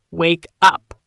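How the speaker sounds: "wake up" word by word, firmer on "up"; slow repeat. Shown as a demonstration. Teacher repeats it for learners, slowly. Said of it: "Let's say it slowly".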